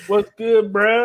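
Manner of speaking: high, loud mock-chummy greeting; imitating overfamiliar greeter. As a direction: put on a cringey homeboy hello. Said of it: "somebody come up to you".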